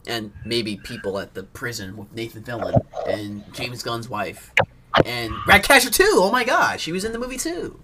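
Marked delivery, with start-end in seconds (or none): 5.0-7.8 high, loud burst of remembering; suddenly light up with delight; much higher, louder excited exclaim; excited recall. Real, not acted.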